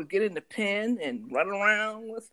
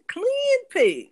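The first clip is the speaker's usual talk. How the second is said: excited; 13 semitones higher, 9 dB louder than usual. Real, not acted. Emphatic praise of pig.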